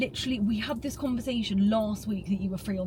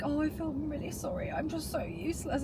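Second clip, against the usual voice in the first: quiet mopey imitation of him, softer; mock sad whine; 5 semitones higher, 9 dB quieter than usual. Girlfriend mimicking her boyfriend's self-pity, soft mock whine. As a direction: whinge it softly like a sulky boyfriend. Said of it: "he was like".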